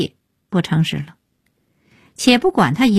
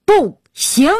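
high-pitched, emphatic refusal; raised voice; 7 semitones higher than usual. A narrator's reading. Mother cuts into the couple's argument, voice suddenly high. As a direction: burst out high on both words, cutting in. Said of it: "声音很高".

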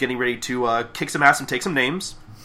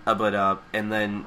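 ordinary talk, nothing marked

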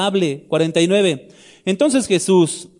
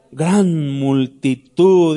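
GRAN drawn out, very slow delivery of "Gran multitud"; "Gran" long and weighty, each syllable drawn out; stress on GRAN; 3 dB louder, much slower than usual. Shown as a demonstration. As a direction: stretch "Gran" wide to show a huge crowd. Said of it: "con énfasis añadido".

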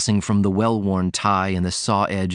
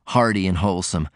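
ordinary delivery

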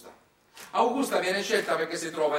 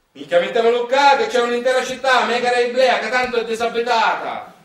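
high, loud eager yell; gleeful shout; 7 semitones higher, 9 dB louder than usual. Acted. Industrialist shouts to build on the Greek ruins. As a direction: shout it like a greedy developer.

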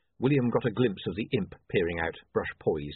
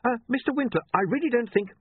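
much higher, panicky polite protest; alarmed protest; 14 semitones higher, 4 dB louder than usual. A narrator's reading. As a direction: try to stop him, alarmed. William protests in horror.

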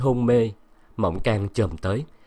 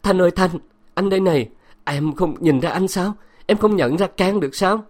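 near shout; 8 semitones higher, 4 dB louder than usual. Acted. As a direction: cry out higher and louder. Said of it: "Cang nói như hét".

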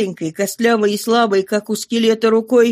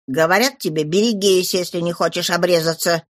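usual speaking voice

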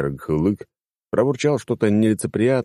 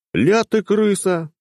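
slow, drawn-out much higher taunt; 3 dB louder, slower than usual. A narrator's reading. Hero drawls a taunt at the treacherous cat. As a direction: stretch the words out mockingly. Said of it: "протянул я".

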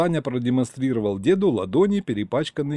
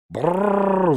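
high, loud indignant dragon roar; the pet dragon shrieking in protest; yelled brrr; 8 semitones higher, 4 dB louder than usual. A narrator's reading. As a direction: bellow it out in outrage. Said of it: "заорал великий домашний".

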